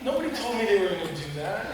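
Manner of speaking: high, soft, hurried protest; mock bewilderment. Co-star retelling an actor's reply, high soft bewilderment. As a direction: squeak out a quick, baffled complaint.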